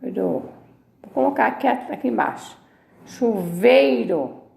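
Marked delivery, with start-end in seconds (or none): 3.0-4.4 "Chuveiro" slowly as chu-VEI-ro; "Chuveiro" drawn out with vei in one glide; three syllables. Shown as a demonstration. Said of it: "Tá vendo?".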